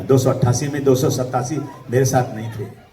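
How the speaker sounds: usual speaking voice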